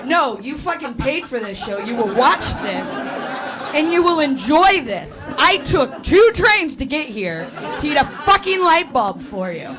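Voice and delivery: hammed-up outrage at the audience; mock indignant scolding. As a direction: order them to watch, leaning hard on each demand.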